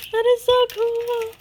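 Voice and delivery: gasp, then very high coo; awed delight. Real, not acted. Delighted reaction to gift.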